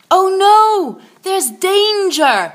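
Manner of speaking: high, loud alarm. A narrator's reading. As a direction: raise the alarm. Narrator signals danger.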